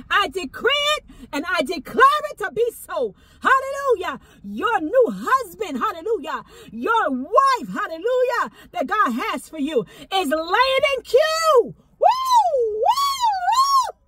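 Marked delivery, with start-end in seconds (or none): 3.4-14.0 high, loud shouted praise; ecstatic preaching. Real, not acted. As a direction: shout it with holy fire.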